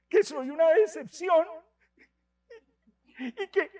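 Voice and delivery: put-on high child's voice with a near-crying break; upset little boy. Boy telling the priest his mother called him a disappointment, tearful and high. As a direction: tell it like a hurt boy, rising as it goes. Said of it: "un niño de quinto".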